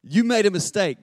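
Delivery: high, loud pronouncement; blunt truth. Acted. Preacher voices truth to the congregation, firm and loud. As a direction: declare the hard fact firmly. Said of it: "That's the truth".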